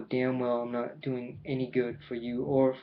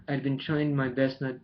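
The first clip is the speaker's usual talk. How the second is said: usual speaking voice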